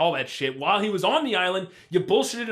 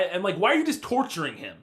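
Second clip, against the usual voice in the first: ordinary talk, nothing marked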